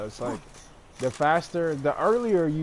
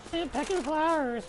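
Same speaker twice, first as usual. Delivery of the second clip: high mocking sing-song; mocking flowery tone. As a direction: scoff at it in a silly high voice. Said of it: "I know it sounds all".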